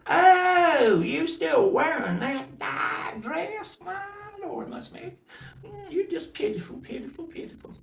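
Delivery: put-on very high, slow sneering girl voice, fading to a whisper; mocking cousins. Cousins sneering at Mutzmag's old dress, high and fading. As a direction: pity her with contempt.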